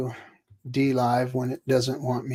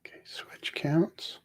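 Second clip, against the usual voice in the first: whispering; 4 semitones higher, 10 dB quieter than usual. Real, not acted. Muttering to self.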